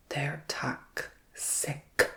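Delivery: They're toxic whispered very slowly, stretching toxic; stress on toxic. Acted. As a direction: drag out toxic in a hushed whisper. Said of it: "Emphasis on the".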